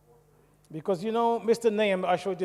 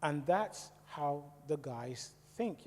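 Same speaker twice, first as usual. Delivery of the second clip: ordinary talk, nothing marked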